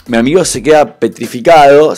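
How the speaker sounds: ordinary talk, nothing marked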